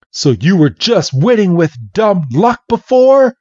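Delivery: hammed-up annoyed rival; upset. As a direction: demand it in retold disbelief. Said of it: "Brock is now getting so upset".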